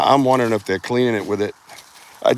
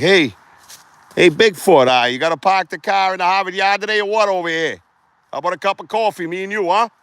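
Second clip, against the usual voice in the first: lightly put-on Boston accent, high and chummy; 10 semitones higher than usual. Vlogger jokingly greets Bigfoot in a light Boston accent. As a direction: hail him like a Boston pal, a hint of Boston. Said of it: "with my Boston accent".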